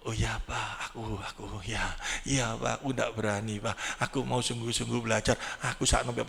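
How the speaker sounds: put-on hushed, low, timid child's pleading; a scolded child murmuring apologies to his father; meek scolded child. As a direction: shrink back and promise to behave. Scolded child's heart answering meekly.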